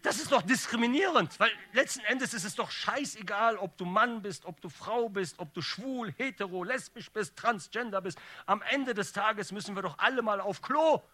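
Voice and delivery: loud, high comic tirade building to a shout; mock-indignant outburst. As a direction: rail at the injustice, climaxing on the last line. Mock-indignant speech building to pun.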